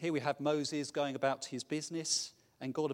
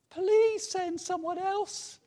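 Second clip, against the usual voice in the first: much higher, slow, loud plea; pleading Moses; 10 dB louder, much slower than usual. Acted. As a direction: beg like a reluctant Moses.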